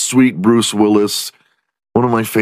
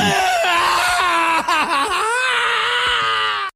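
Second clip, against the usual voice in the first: shrieking laugh. Real, not acted. Howling laugh at own joke.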